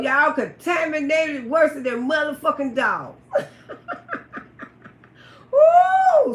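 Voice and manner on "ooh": very high loud ooh; big ooh. Real, not acted. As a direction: let out a huge outraged ooh. Outraged punchline.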